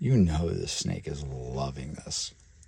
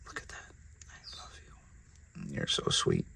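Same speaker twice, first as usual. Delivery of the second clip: tender pet talk; 10 dB quieter than usual. Real, not acted. Talking tenderly to the snake.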